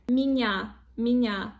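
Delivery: меня as "minya" with one soft N; soft sound, no Y. Shown as a demonstration. Teacher correcting the mistake, soft N in меня. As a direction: say меня joining soft N straight to A. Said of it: "There is no".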